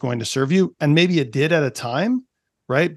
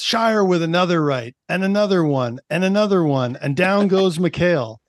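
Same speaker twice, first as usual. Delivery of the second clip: higher-pitched play-by-play call, each phrase punched; excited sportscaster impression, higher than usual talk; hockey broadcaster; 5 semitones higher than usual. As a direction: imitate a broadcaster calling a hockey fight. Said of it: "I believe the broadcaster says".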